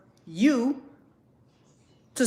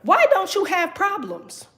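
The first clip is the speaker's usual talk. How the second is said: mock amazed; 6 semitones higher, slower than usual. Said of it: "they be like".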